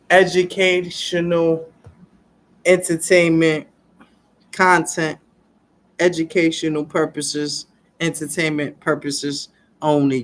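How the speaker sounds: disclaimer announcement. Acted. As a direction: spell out the disclaimer word by word. Video disclaimer outro.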